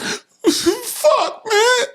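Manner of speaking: very high fake sobbing whine; badly faked blubbering; mock crying. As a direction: ham up a man breaking down in tears.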